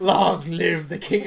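slow, loud Scar quote; mock villain line. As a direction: deliver the villain's line slowly. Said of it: "And he's like".

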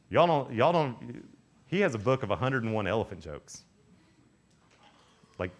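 normal talking voice

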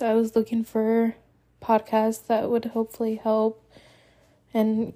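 normal talking voice

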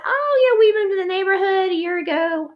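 high, bright sociable voice; friendly neighbor chat. Retold or imitated. Greeting a passing couple about the roses, high and bright. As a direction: pitch it up, chirpy and friendly.

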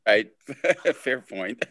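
amused, laughing agreement; laughing concession. Real, not acted. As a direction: concede the point through laughter. Listener laughs while conceding the point.

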